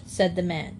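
usual speaking voice

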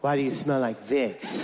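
low mocking kid taunt; a hint of a teasing bully. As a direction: taunt him, low and sneering. Bully mocks in story.

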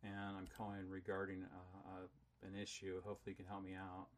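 soft, calm polite opening; quiet. Real, not acted. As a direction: start gently. Polite opening before the fight.

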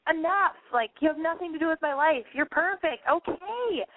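high-pitched mock exasperation; exasperated. Vlogger mock-scolding a too-perfect actress, exasperated. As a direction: raise the voice in pitch, fed up. Mock rant at Gwyneth Paltrow.

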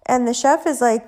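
normal talking voice

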